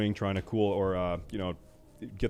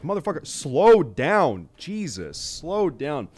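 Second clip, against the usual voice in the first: slow, louder exasperated yell; mock yelling at driver; 5 semitones higher, 5 dB louder, slower than usual. At a speeding German driver, a mock exasperated yell. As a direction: yell it out the windshield, slowed for effect. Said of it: "It's like".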